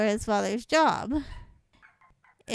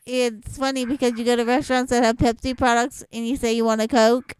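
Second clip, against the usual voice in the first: usual speaking voice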